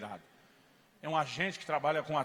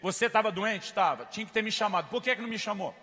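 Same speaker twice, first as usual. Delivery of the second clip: loud, higher pointed questions; scolding; 4 semitones higher, 6 dB louder than usual. Retold or imitated. Pastor grilling the sister, loud reproach. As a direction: grill her.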